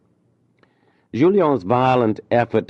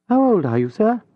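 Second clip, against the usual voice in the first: higher, lightly voiced gentle lady; lady's voice; 3 dB louder than usual. A narrator's reading. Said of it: "lady asks Julien".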